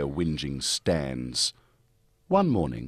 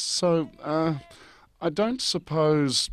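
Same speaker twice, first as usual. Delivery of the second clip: higher, slow pleading whine; begging; 8 semitones higher than usual. Acted. Stans begging the Virgin for food, higher whiny plea. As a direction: beg like a hungry brat. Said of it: "begged Stans".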